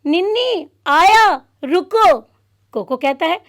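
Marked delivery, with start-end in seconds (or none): 0.1-2.2 higher, louder shout toward the door; boy shouting to his friend at the door; loud call. Acted. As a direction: call out to hold him off. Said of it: "ज़ोर से आवाज़ लगाता है".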